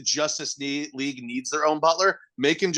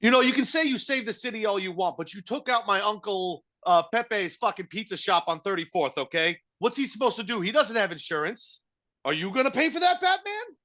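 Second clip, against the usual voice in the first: much higher, hammed-up aggrieved Italian; mock wiseguy complaint; 9 semitones higher than usual. Italian nephew demands Batman pay for pizza shop.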